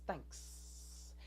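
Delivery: hissed, voiceless snake whisper; talking snake. Acted. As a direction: hiss it softly like a snake. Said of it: "the snake can talk".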